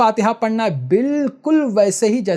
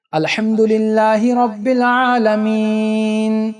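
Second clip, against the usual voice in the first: Quran recitation; 5 semitones higher, much slower than usual. Shown as a demonstration. Teacher reciting Fatiha for students to copy, slow and melodic. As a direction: chant each verse long and tuneful. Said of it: "کافی غور سے سنو".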